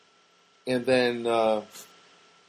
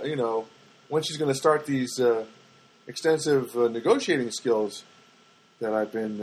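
ordinary talk, nothing marked

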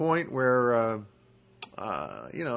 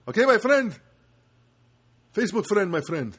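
put-on louder, higher Armenian accent; Armenian accent impression; 7 semitones higher, 5 dB louder than usual. Comic signing off to a Facebook friend, in an Armenian accent. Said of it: "Doing my Armenian impression".

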